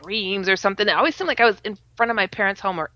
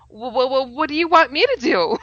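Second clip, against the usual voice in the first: stammering "wha-" before a higher question; stuttering; 5 semitones higher than usual. Retold or imitated. She asks Jesus what he wants, stammering. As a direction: stumble over the first word in awe. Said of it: "I'm stuttering again".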